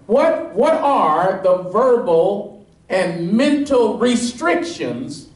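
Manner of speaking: high, ringing preacher's climb, pressing each point; preaching at full force. Acted. Pastor pressing the congregation on the leper's "If", fervent preaching. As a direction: drive the point home to the congregation.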